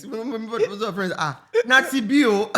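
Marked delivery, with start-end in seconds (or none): 1.2-2.5 put-on laughing Nigerian accent quote; louder, higher Nigerian accent. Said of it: "with that Nigerian accent".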